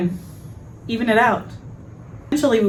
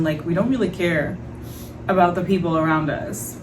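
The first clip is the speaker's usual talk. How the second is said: normal talking voice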